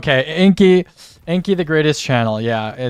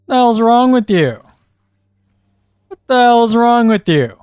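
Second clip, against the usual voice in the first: loud, higher exasperated yell; angry yelling; 8 semitones higher, 7 dB louder than usual. Real, not acted. Gamer yelling at a teammate over voice chat.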